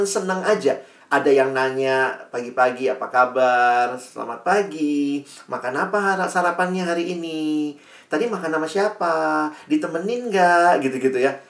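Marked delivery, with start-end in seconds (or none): 2.9-10.8 flirty chatter. Retold or imitated. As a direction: mimic cooing chat messages, stretching the endings. Imitates flirty morning chat messages.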